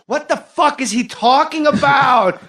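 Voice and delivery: high, loud, slow outraged yell; heckler shout. Retold or imitated. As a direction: yell it at the comic in disbelief. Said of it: "she goes".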